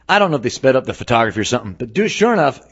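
normal talking voice

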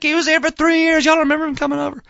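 put-on high, fast, insistent plea to his kids; excited insistence. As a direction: make them remember him, almost shouting. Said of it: "I'm like".

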